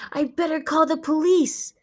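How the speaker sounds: urgent, hushed whisper; frightened whisper. Acted. Dog alone at home whispering to herself, frightened. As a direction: keep it secret, scared.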